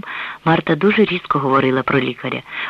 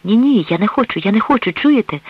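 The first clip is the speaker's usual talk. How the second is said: higher-pitched, restrained cry of refusal; 6 semitones higher than usual. A narrator's reading. Woman refusing the priest's urging to leave, crying out. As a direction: protest in a mild narrated outcry. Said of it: "скрикнула вона".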